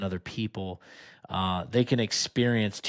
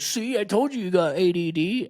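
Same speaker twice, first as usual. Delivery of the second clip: wife; 10 semitones higher than usual. Retold or imitated. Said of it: "my wife would be like".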